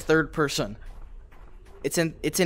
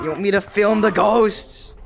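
incredulous outburst; 5 semitones higher, 7 dB louder than usual. Real, not acted. Incredulous at ghost hunting request.

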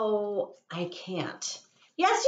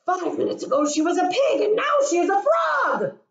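rising higher and louder, speeding up at the end; building alarm; 7 semitones higher, 3 dB louder, much faster than usual. Acted. Gerald frets to himself about Piggie's change, building up. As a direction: work yourself into a panic.

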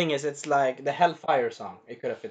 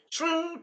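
high, softer ringing shing; teleport sound effect; 10 semitones higher, 5 dB quieter than usual. Retold or imitated. Teller shows a repeated teleportation, high soft effect. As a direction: imitate a magical zip, high and light.

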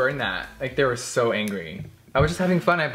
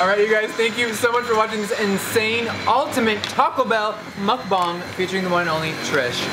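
upbeat, higher host sign-off; vlog outro; 7 semitones higher than usual. Acted. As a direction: sell the outro with energy.

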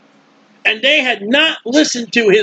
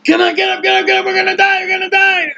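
much higher, fast panicked pleading; frantic disciples; 8 semitones higher, faster than usual. Retold or imitated. Disciples shaking Jesus awake, much higher and frantic. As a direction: hurry him awake, sure they'll drown. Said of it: "You, you know what he felt?".

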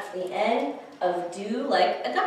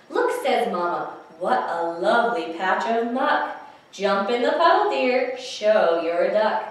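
lively storytime read-aloud; animated picture-book reading; reading to children; 4 dB louder than usual. Acted. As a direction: read it like storytime for little ones.

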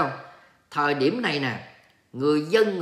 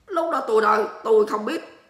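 playful flustered protest; 6 semitones higher, faster than usual. Pretend. Playfully dodging an embarrassing question.

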